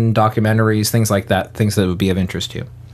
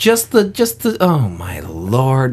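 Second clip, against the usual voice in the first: exasperated; much slower than usual. Real, not acted. Host despairs of his confused co-host, exasperated and drawn out. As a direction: draw out the exasperation.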